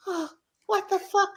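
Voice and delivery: very high mock gasp; mock shocked lady. The lady reacting to the finger, very high and shocked. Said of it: "she was like".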